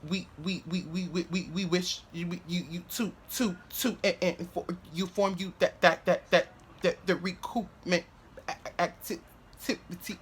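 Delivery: soft, halting stuttered reading aloud; mock-stammered reading, repeating words; stumbling reader. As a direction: imitate a classmate tripping over every word. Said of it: "I'm just gonna use this as prime example".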